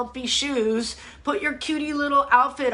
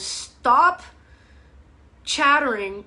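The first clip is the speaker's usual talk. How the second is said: ordinary talk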